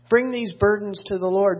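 ordinary talk, nothing marked

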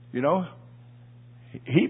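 ordinary talk

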